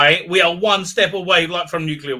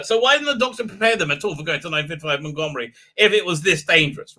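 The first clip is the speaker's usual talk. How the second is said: ordinary talk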